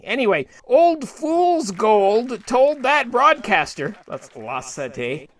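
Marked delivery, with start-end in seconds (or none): 4.1-5.3 low, soft, slow "La Sette"; muttered aside. Shown as a demonstration. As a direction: drop "La Sette" in as a quiet aside. Aside repeating the channel name.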